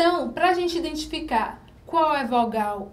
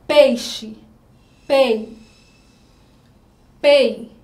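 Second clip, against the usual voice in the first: pei said loud and held, much louder than the explanation; stressed syllable, louder; 8 dB louder, much slower than usual. Shown as a demonstration. Teacher isolating pei for pupils, loud. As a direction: call out pei loudly so the strong E stands out. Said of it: "A letra E é a mais forte".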